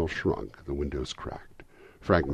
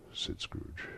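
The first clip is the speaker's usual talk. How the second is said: ordinary delivery, nothing marked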